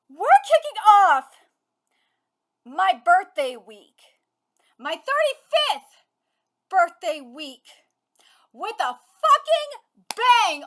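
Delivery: loud, laughing, punchy announcement; excited. Real, not acted. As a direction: hype up the birthday week. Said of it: "I am so excited".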